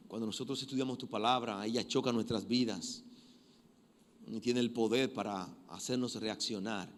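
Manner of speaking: hushed heartfelt prayer; closing prayer. Acted. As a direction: pray humbly for the church.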